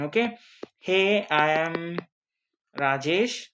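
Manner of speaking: ordinary talk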